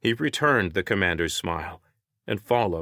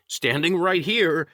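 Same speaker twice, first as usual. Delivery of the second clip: higher, slow pointed reminder; raised, exasperated protest; annoyed; 10 semitones higher, 4 dB louder than usual. A narrator's reading. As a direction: stretch it out, irked.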